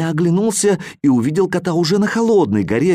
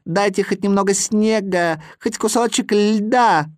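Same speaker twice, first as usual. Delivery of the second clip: put-on whimpering Chukchi boy; 6 semitones higher than usual. A narrator's reading. Little Chukchi boy melting in heat begs for snow, whimpering. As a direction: beg for a bit of ice. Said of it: "плакал маленький Чукча".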